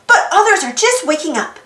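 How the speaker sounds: usual speaking voice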